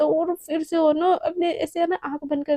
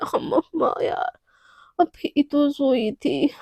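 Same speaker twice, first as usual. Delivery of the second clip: sleepy groan; much slower than usual. Acted. Naina woken up after sleepless night.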